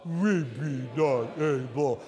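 low, soft, slow whine; whiny pout. Shown as a demonstration. Preacher modelling the doubting side's line, low. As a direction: sulk it out. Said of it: "you have to sort of whine".

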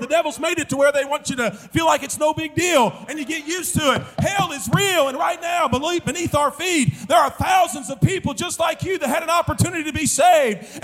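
high-pitched, driving revival preacher; urgent hellfire preaching. Real, not acted. Preaches at full force.

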